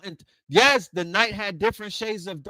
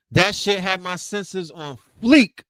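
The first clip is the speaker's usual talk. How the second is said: normal talking voice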